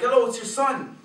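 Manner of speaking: excited loud call to a father. Retold or imitated. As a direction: call out so dad can hear. Calling out to dad across street.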